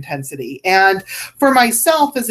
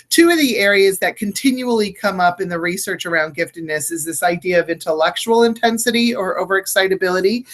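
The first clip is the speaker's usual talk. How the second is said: ordinary talk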